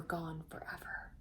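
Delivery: sad whispered line; hushed sorrowful narration. A narrator's reading. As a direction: whisper the loss. Sad moment in story.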